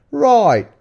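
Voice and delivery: loud, high Cockney exclamation; one-word burst of hammed-up Cockney; mock Cockney. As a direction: cry it out in broad Cockney. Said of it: "I'm going Cockney".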